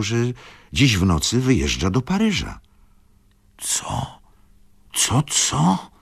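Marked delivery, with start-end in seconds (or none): 3.6-4.2 breathless whispered co; shocked gasp of a word; startled whisper. A narrator's reading. Tomasz whispers in shock at Izabela's news. 4.9-5.9 high, alarmed repeated question; shocked father cries out; alarmed exclamation. A narrator's reading. Tomasz cries out, alarmed, to his daughter. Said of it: "zawołał pan Tomasz".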